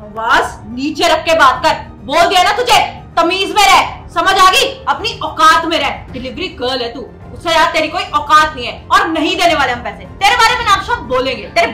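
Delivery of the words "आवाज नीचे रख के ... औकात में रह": loud, forceful telling-off; angry scolding. Acted. Customer puts delivery woman in her place, loud and angry. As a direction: order her sharply into line.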